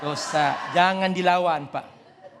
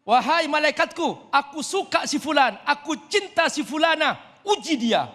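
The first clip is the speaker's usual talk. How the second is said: voicing Allah's command; 8 semitones higher than usual. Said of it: "kata Allah".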